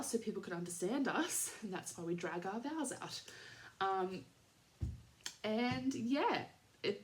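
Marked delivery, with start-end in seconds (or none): none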